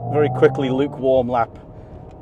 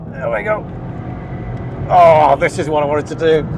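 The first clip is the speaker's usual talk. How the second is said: louder, delighted outburst; excited; 2 semitones higher, 5 dB louder than usual. Real, not acted. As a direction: let the joy burst out. Thrilled driver on hot lap.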